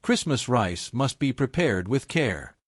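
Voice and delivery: usual speaking voice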